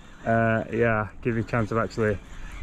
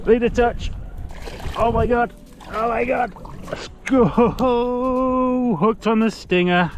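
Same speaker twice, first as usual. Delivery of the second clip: thrilled; 9 semitones higher, slower than usual. Real, not acted. Landing the long-awaited fish.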